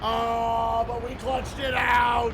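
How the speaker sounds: excited shout. Real, not acted. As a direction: shout it out in relief. Celebrating the clutch win.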